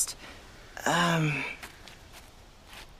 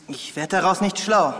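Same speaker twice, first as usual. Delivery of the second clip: usual speaking voice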